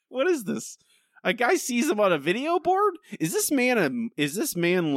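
high-pitched, exasperated disbelief; incredulous. Real, not acted. Podcast host baffled by a dumb plot, incredulous. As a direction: pitch shoots up in baffled protest.

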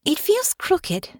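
put-on a worried girl asking for reassurance; nervous young Honor. Nervous Honor fusses with scarf.

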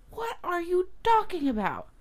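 very high-pitched incredulous question; exasperated bafflement. Retold or imitated. Parent pressing the upset girl for sense, incredulous. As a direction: squeal it in disbelief, falling at the end.

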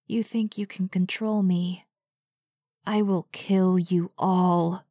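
lightly voiced disembodied threat, drawn out; menacing entity. A narrator's reading. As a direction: let the threat hang slowly in the air. Voice from everywhere threatens them.

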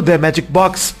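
ordinary talk

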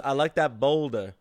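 ordinary delivery

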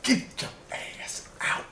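raspy unvoiced whisper; hoarse whisper. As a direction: hiss it out, voiceless. Voicing parent kicking out.

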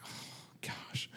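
hushed, breath-only dismay; sinking, barely voiced regret; stricken retold sigh. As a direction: let it out as a guilty whisper. Retells pang at daughter's remark.

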